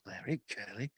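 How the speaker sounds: a polite British gentleman scolding Larry and Curly; James Mason voice. Pretend. Host imagines James Mason as a Stooge, mild British accent. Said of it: "imagine if James Mason was one of the Three Stooges".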